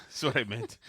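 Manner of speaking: ordinary talk, nothing marked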